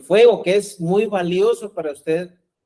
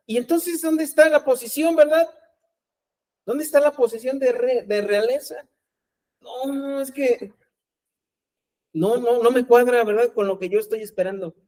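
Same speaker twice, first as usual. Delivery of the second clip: acted objection; 6 semitones higher than usual. Acting out the let-down disciples.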